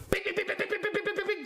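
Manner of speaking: high-pitched rapid-fire bing-bing; rapid button noises. Acted. Kids pushing teacher's buttons, rapid high bings. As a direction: jab out a string of high beeps.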